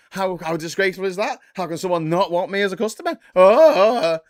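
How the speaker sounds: loud, high-pitched wounded indignation; mock outrage. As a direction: wail in hammed-up disbelief. Farage outraged at being refused.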